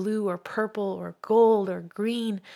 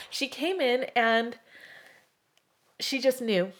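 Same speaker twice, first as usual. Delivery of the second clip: emotional; 4 semitones higher than usual. Real, not acted. Shop owner recalling her friend's kindness, choked up. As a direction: let the feeling well up as you remember. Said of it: "I'm gonna get emotional again".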